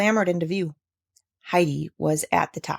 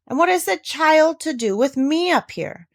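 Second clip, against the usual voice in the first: sharp, raised, curt question; curt old man; 9 semitones higher, 3 dB louder than usual. A narrator's reading. Grandfather curtly questioning Deet about the child. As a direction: cut her short. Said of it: "asked the old man curtly".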